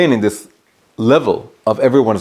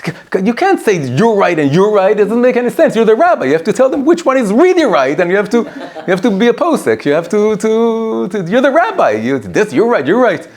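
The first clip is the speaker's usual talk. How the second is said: high, louder scolding wife voice; a wife berating her husband, pitched way up; exasperated wife; 10 semitones higher, 3 dB louder than usual. Retold or imitated. Wife scolding the rabbi husband, high and exasperated. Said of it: "she goes to her husband and she says".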